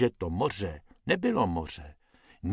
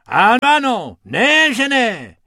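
thundering; 11 semitones higher, 9 dB louder than usual. Acted. Hippo overruling Bobek's protest, thundering. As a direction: boom over the objection. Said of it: "římal Hubert".

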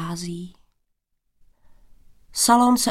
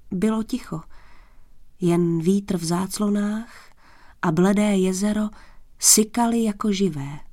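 ordinary delivery, nothing marked

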